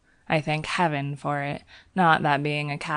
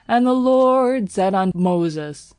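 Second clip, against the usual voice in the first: bellowing preacher; 2 semitones higher, 6 dB louder than usual. Acted. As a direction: bellow it from the pulpit. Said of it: "when he bellowed".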